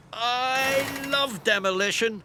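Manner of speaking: high, eager little digger; excited. A narrator's reading. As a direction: gush with excitement. Alfie voiced eagerly.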